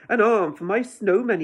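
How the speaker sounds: put-on cheerful boy. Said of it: "he says with a laugh".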